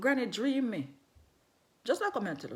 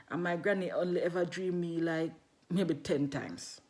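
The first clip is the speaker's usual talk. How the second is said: ordinary talk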